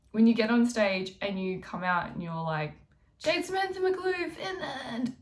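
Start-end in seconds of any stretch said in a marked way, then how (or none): 3.2-5.1 high thin intro that fades out; a name that dies as it comes out; nervous intro. Shown as a demonstration. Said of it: "it doesn't come out".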